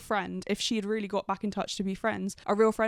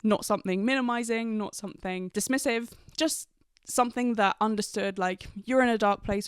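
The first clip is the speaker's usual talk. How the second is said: ordinary delivery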